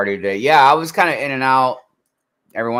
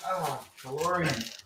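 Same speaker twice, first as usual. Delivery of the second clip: awed movie quote; 2 semitones higher, 11 dB quieter than usual. Retold or imitated. Quoting Back to the Future.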